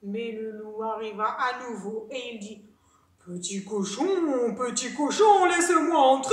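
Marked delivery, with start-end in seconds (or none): 3.2-6.3 big bad wolf at the door; wolf calling out. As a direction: stretch it, then swell to a demand. Wolf calls at second house.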